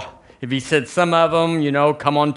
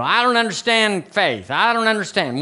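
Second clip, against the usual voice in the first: mock complaint, pitched higher; doubter's complaint; 6 semitones higher than usual. Preacher voicing a listener baffled by faith, mock complaint.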